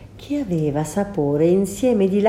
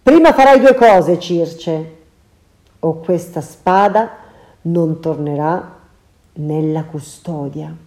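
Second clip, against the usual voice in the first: loud, commanding warning; stern hero threat; 5 dB louder than usual. Acted. Ulysses threatening Circe with his sword, stern and loud. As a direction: lay down the terms.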